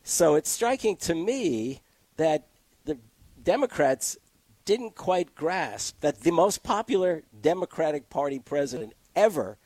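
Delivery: ordinary talk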